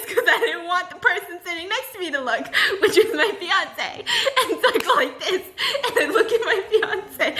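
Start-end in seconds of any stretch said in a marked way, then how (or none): none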